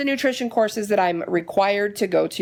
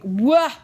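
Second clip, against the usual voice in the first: higher, louder cartoon baby wail; mock wail; 6 semitones higher, 4 dB louder than usual. Reacting to the long surgery wait, with a higher, louder mock wail. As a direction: wail like a bratty toddler.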